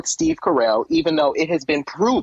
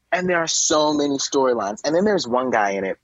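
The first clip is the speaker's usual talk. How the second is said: ordinary delivery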